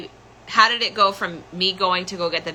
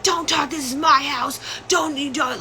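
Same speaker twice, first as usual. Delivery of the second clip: high-pitched mock yell; much higher voice, yelling without full volume; retold flipping out; 8 semitones higher than usual. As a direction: imitate an irate father cutting someone off. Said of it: "He's flipping out on me".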